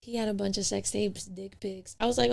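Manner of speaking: ordinary talk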